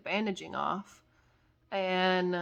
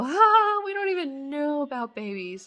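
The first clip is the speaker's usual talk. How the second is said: mock nurse; 8 semitones higher, 9 dB louder than usual. Nurse quoted carelessly admitting ignorance.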